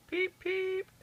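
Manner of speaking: very high piping whistle call; engine whistle peep. A narrator's reading. Thomas whistles to people.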